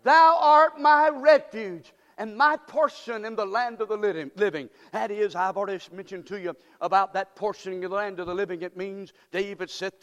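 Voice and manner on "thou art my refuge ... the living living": preacher leading the church in unison; call and response lead. Shown as a demonstration. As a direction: lead them in saying it. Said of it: "One more time".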